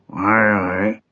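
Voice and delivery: puzzled grumbling king; perplexed. A narrator's reading. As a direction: mumble a very doubtful assent. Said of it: "très perplexe". (grommeler, très perplexe)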